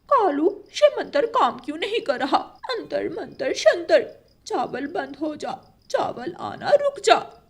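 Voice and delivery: high, frantic chant with nervous laughter; panicked spell. Acted. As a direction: crow wife panics as her spell fails, high and frantic. Spell fails, rice keeps coming.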